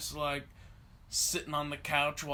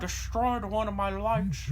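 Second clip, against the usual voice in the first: put-on old man's complaint; complaining old man; 3 semitones higher than usual. Old customer phones about his broken light, put-on old man. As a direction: keep up the mock old caller. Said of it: "the old guy was probably on the phone like".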